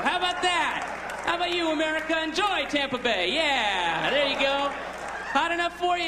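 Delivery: high-pitched, hammed-up taunting elephant; gleeful vengeful elephant. Comedian voices the elephant spraying the crowd, hammed-up taunting. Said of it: "now it's starting to get its energy".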